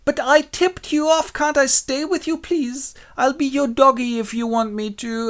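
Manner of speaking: high, whiny-needy begging voice; grovelling Helena. Acted. Helena begging Demetrius to keep her, grovelling. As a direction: plead abjectly at high pitch. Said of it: "she really humiliates herself".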